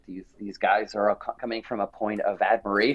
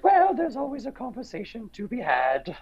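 the Queen, amused; 12 semitones higher than usual. Retold or imitated. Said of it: "she chuckled and she says".

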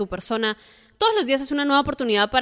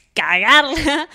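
laughing; 4 semitones higher, 7 dB louder, much slower than usual. Real, not acted. Repeated word, laughing takes.

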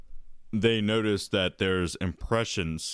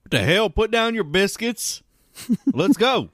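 put-on old prospector; 12 semitones higher, 4 dB louder than usual.

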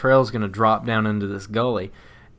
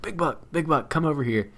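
higher, held-back urgent call; urgent retold alert; 4 semitones higher, 4 dB quieter than usual. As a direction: act out calling a partner over without yelling. Urgently alerting partner to a buck.

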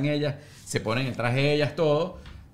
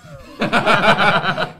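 loud laugh; 8 semitones higher, 9 dB louder than usual. Real, not acted. Laughs at own question.

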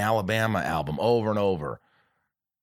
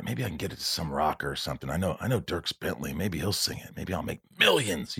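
quiet musing; 7 semitones lower, 7 dB quieter than usual. Retold or imitated. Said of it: "and I'm like".

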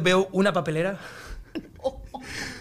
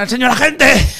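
ordinary delivery

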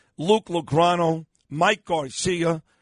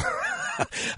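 laughing; 7 dB quieter than usual. Real, not acted. Laughs at Hulk Hogan source.

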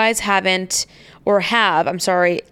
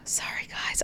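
whispering; 14 dB quieter than usual. Real, not acted. Apologizing while gagging.

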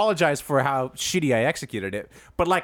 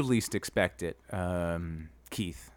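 menacing threat; 7 semitones lower, 4 dB quieter, slower than usual. Acted. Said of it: "really sounding like Draymond right now".

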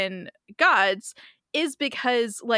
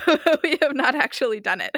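laughing; 4 semitones higher, 4 dB louder than usual. Real, not acted. Laughing while speaking.

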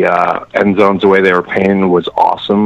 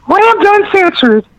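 very high, slow crooned words; sweet nothings; 4 dB louder, slower than usual. Pretend. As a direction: coo it high and slow into his ear. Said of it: "whispering sweet nothings in his ear".

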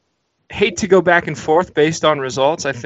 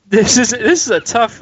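higher-pitched, laughing through the words; 6 semitones higher, 3 dB louder than usual. Real, not acted. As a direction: stall with a laugh as you speak. Laughing at being put on spot.

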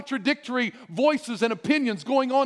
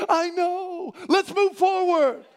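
reassuring; 8 semitones higher, slower than usual. Acted. God voiced gently urging on.

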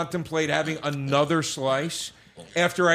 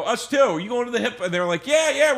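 high, lively, louder exchange; excited chat; 9 semitones higher, 5 dB louder than usual. Retold or imitated. Chatting with the couple about the concert, high and excited. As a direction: perk up like meeting new friends.

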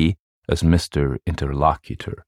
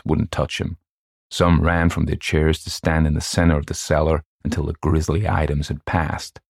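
ordinary delivery, nothing marked

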